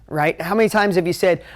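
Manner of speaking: ordinary delivery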